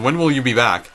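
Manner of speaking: ordinary talk, nothing marked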